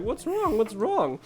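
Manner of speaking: high-pitched worried friends; mock alarm. Friends react to the player's screaming, mock alarm. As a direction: imitate friends asking in alarm.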